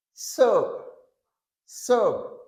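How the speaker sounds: "Soap" with one long O, A silent. Shown as a demonstration. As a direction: say "Soap" as "sope", no A sound. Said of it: "Nou pa pwononse A".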